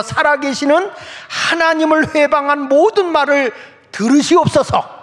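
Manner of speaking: high, forceful plea to heaven; fervent prayer. Acted. Hezekiah praying about Sennacherib's insults, fervent plea. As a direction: raise the voice high, implore God to hear. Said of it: "하나님께 기도하는 거예요".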